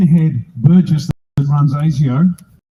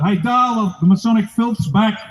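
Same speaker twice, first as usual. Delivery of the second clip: mock yell out; 6 semitones higher than usual. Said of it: "'cause I used to yell out".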